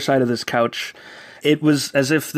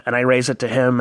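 ordinary delivery

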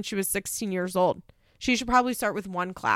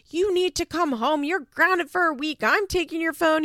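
mock scolding mom; 9 semitones higher, 4 dB louder than usual. Voicing Mackenzie scolding her son.

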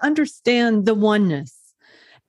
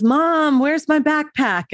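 higher-pitched mock yell for Mom; son yelling, retold; 6 semitones higher, slower than usual. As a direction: imitate a kid calling out impatiently. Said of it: "yells at me and says".

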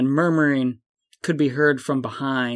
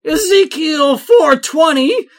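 much higher, loud yell; shouted protest chant; crowd shout; 11 semitones higher, 6 dB louder, slower than usual. A narrator's reading. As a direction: scream it like a protester. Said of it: "cries of".

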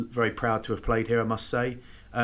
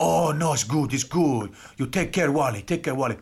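Argentinian prop; 3 semitones higher than usual. Retold or imitated. Argentinian prop taking pity on hungover teammate, imitated accent. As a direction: reassure in broken English, imitated Argentinian accent. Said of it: "the Argentinian prop".

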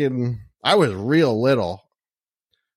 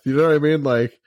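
ordinary talk

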